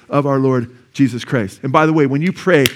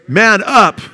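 punchy, raised-voice retold challenge; higher, louder, like ordering someone to step up; forceful challenge; 6 semitones higher, 6 dB louder than usual. Preacher relaying a woman's challenge to a father, forceful.

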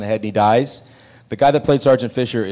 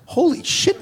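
much higher, breathless disbelief; shocked holy shit; slower than usual. Retold or imitated. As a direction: gasp it out in shock. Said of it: "I had this ... moment".

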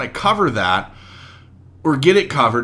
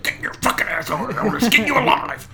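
mock angry threat; 8 semitones higher than usual. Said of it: "You're like".

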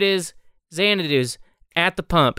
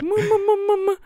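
much higher mock sobbing syllables; blubbering kid; 14 semitones higher, faster than usual. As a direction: blubber like a small child. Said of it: "the kid is like".

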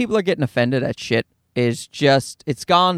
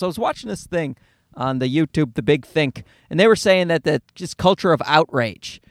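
ordinary talk

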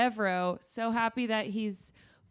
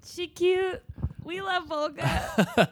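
high, loud, gushing squeal of delight; 10 semitones higher, 5 dB louder, slower than usual. Real, not acted. Fan gushing to her co-host about a beloved character, high and loud. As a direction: coo over her, louder and higher. Echoing gushing over Volga.

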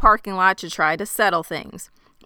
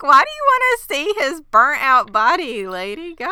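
mock incredulous; 8 semitones higher, 6 dB louder than usual. Mocking grandma's request.

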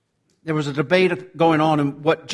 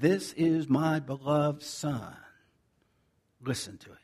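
hushed heavenly voice; 4 semitones lower, 7 dB quieter than usual. Retold or imitated. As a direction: lightly voice God speaking from the sky. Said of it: "you hear a voice from above that said".

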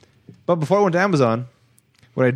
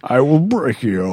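barbarian; slower than usual. Acted. Menacing movie quote.

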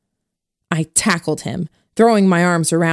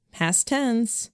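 usual speaking voice

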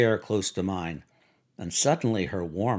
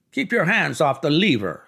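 sharp, higher and firmer command; brisk, raised reprimand; snapped order; 5 semitones higher, 4 dB louder than usual. A narrator's reading. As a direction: snap it like an irritated elevator boy. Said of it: "snapped the elevator boy".

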